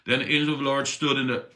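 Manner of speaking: usual speaking voice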